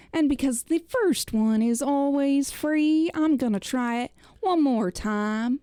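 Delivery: ordinary talk, nothing marked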